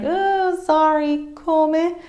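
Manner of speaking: high, apologetic query; sincere question. Shown as a demonstration. Teacher shows how not to seem sarcastic, high questioning tone. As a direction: pitch it well up, plainly asking. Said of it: "saying it as a question".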